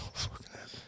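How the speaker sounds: barely voiced, whispered reaction; retold shock in a whisper; hushed disbelief. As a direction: say it under your breath, aghast. Muttered reaction in retold story.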